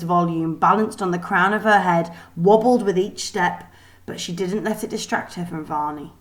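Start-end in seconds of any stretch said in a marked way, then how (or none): none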